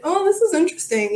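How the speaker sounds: high, quick mock-curious "oh"; mock intrigued. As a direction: play the detached horror fan, intrigued not scared. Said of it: "be like".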